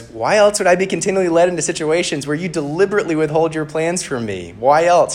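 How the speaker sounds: rapid, high-pitched indignant complaint; bickering. Acted. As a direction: demand an answer from an infuriating friend. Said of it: "they're bickering with each other".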